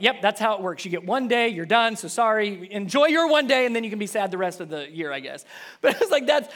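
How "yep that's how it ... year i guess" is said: loud, mock-resigned teasing; teasing dad. Dad jokes to his daughter, loud mock-sorry teasing. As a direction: tease the child with fake sympathy. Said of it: "I'm just like".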